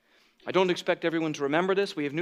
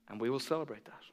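usual speaking voice

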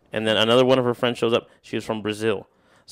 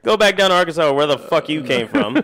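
loud, higher comic tirade; mock angry outburst; 7 semitones higher, 6 dB louder than usual. Man retells telling stranger off, mock-angry. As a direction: hurl it as a hammed-up send-off.

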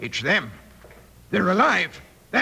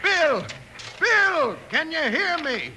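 calling out; 10 semitones higher, slower than usual. Acted. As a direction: shout his name through the wall. Calling through rubble.

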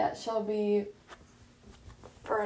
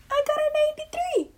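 much higher and louder, proud; excited; 7 dB louder than usual. Real, not acted. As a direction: burst out with the good news. Excited about her grade.

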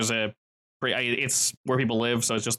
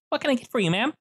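put-on high, bright young man's voice, much higher; pitched-up friendly counter guy; cheery young pizza worker; 12 semitones higher, faster than usual. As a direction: greet the customer eagerly. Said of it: "and says".

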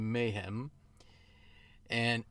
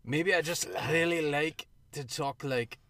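put-on playful Scottish accent, pitched up; 4 semitones higher than usual. Host admitting he likes talking like this, Scottish accent. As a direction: slip into a Scottish lilt. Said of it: "started off with a Scottish accent".

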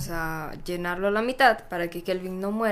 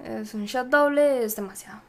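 usual speaking voice

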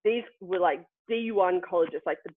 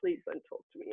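fast breathy whisper of inner dread; whispered thought; 10 semitones higher, 10 dB quieter, faster than usual. Retold or imitated. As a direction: whisper it quickly to yourself. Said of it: "And I was just like".

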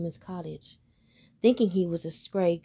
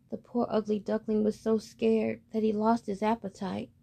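ordinary delivery

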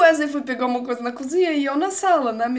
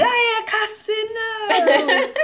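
mock TV-host cry; 9 semitones higher, much slower than usual. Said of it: "E ele".

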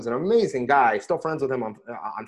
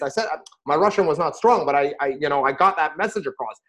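usual speaking voice

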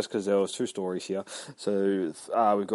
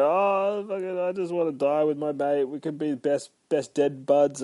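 mock weepy movie actor; 6 semitones higher than usual. Imitating weeping dude in movie.

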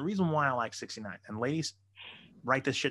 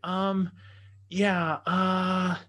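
high, hesitant voice, raised as if through a door; drawn-out, sheepish higher voice; embarrassed woman, imitated; 7 semitones higher, 4 dB louder, much slower than usual. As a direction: imitate her stalling awkwardly, pitched up and louder. Imitates woman behind bathroom door.

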